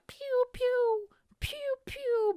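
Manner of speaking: very high cartoon pew pew; high sound effects for someone rushing by; zippy pew sounds. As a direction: zip past like a laser. Imitating waitress zipping past.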